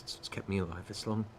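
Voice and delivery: soft, subdued parting words; hushed farewell. Acted. Daughter at the door telling the father, hushed. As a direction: say it quietly, holding back tears.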